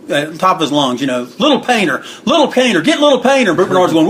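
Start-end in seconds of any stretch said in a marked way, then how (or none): 1.4-3.5 high-pitched mock yell, rising with each call; higher each time, like imitating a wrestler's yell; imitated yelling. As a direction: egg him on in a retold holler. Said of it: "he's yelling at the top of his lung".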